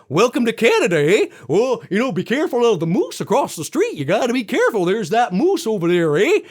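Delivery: put-on lilting caricature Canadian accent; dumb-sounding Canadian accent. Warning a visitor about moose, hammed-up Canadian accent. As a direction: play a dim, friendly cartoon Canadian accent. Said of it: "like we're really, really dumb".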